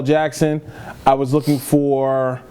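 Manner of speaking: ordinary talk, nothing marked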